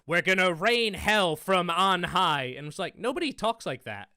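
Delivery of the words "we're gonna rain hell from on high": slow, loud, overblown movie hero line; mock heroic line. Mocking Tom Hanks' written line, slow and loud. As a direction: boom it like a cheesy war hero. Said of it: "I think it was".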